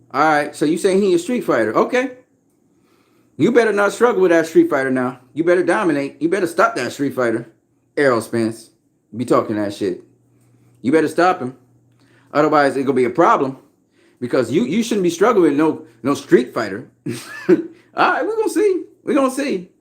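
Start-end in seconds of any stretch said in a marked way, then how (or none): 1.8-2.2 sarcastic. Real, not acted. Sarcastic reply to street fighter claim. 17.5-19.7 mocking. Real, not acted. Laughing, mocking challenge to Spence.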